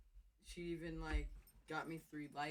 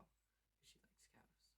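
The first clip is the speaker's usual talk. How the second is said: sudden whisper; whispered aside; 24 dB quieter, much slower than usual. Real, not acted. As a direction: drop to a whisper. Aside about girlfriend's cows.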